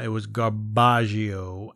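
"garbaggio" stretched out slowly with an Italian-style ending; low, slow joke pronunciation of "garbaggio"; mock-Italian word. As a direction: draw out "garbaggio" as fake Italian.

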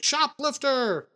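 high, drawn-out cry of shoplifter; retold yell. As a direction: shout the alarm for the cop. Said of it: "he yells for the cop".

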